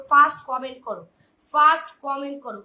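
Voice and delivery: loud urgent call to the chat; calling out loudly. Real, not acted. Host urging live viewers to comment first, loud call. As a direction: shout the order to the viewers.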